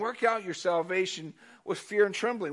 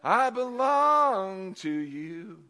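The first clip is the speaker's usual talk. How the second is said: very slow, tender "Abba, I belong to you"; slow, heartfelt prayer voice; 5 semitones higher, much slower than usual. Retold or imitated. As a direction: say it like a child to a father. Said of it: "how many of you said".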